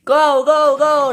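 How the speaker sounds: high, loud repeated urging; shout. Acted. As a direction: shout them on insistently. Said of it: "they shouted".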